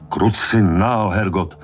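high, drawn-out furious swearing; acted roar of frustration; roared curse. A narrator's reading. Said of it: "zařval Quint".

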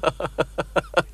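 a soft delighted laugh; happy laughter at being called young; joyful laugh. Acted. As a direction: laugh with sudden relief. Joy at being thought younger.

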